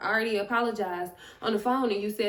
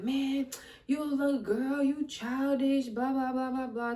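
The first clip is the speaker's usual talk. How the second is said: scolding brother; 4 semitones higher than usual. Retold or imitated. Retells brother berating her on phone.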